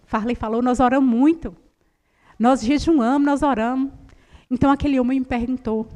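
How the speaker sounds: ordinary talk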